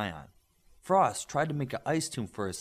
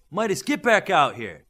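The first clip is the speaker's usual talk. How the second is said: loud, high call across the pit; calling out loudly; 6 semitones higher, 11 dB louder than usual. A narrator's reading. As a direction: yell him back from the edge. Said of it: "Smith called from the edge".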